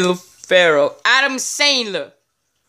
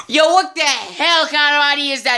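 mocking scoff; 5 semitones higher than usual. Scoffing at dumb idea.

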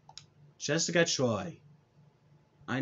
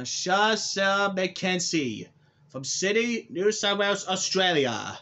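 ring announcer; 6 semitones higher, 3 dB louder than usual. Acted. Announcing opponent's name.

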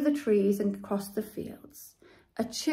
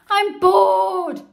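groan; 10 semitones higher, 11 dB louder than usual. A narrator's reading. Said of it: "groaned Henry".

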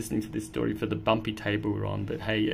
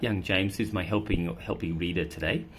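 ordinary delivery, nothing marked

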